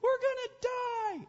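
hammed-up schoolgirl panic; panicked shriek. Preacher mocking the disciples in the storm, screaming in fake panic. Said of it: "hairy dudes are in a boat going".

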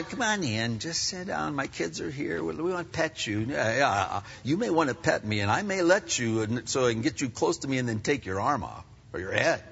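a soft, coaxing welcome to a rabid lion; mock friendly invite. Preacher voicing a fool inviting a lion in, soft and coaxing. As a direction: coax the lion in, hushed and sweet.

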